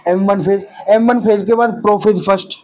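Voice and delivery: normal talking voice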